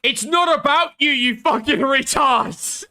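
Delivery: screaming at the video, fed up; angry shout. Real, not acted. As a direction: scream it at the screen in disgust. Yelling back at the video.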